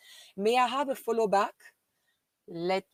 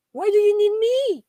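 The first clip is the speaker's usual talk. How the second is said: very high, loud squeal of disbelief; amazed shriek; 10 dB louder than usual. Real, not acted. Streamer to viewer with huge fan count, amazed shriek. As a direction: shriek it in excited astonishment.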